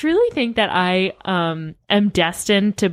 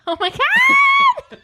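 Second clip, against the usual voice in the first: laughing squeal, much higher and louder; delighted squeal; 5 dB louder, much slower than usual. Real, not acted. Host squeals, laughing, at being called future-famous.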